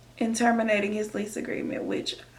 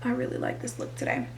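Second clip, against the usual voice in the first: whispered aside; 11 semitones lower, 11 dB quieter, faster than usual. Real, not acted. Self-aside mid-story, under breath.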